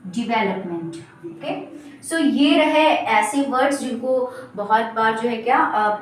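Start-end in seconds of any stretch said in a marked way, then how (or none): 0.1-1.0 "डेवलपमेंट" lower and softer, as di-VEL-up-ment; correct development. Shown as a demonstration. Teacher drilling learners, soft di-vel-up-ment. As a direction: repeat "डेवलपमेंट" softly, stressing vel. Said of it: "correct pronunciation".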